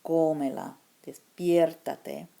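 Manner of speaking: cómela with the first syllable stressed, despiértate said slowly; stressed vowels. Shown as a demonstration. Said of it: "the loudest vowel".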